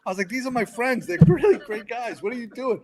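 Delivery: high-pitched retold protest; indignant defence. Firefighter defending filmmaker friends to colleagues, high indignant protest.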